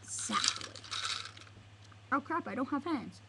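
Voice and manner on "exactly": voiceless whispered reply; hushed, all breath. Acted. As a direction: whisper the word. Unvoiced one-word reply.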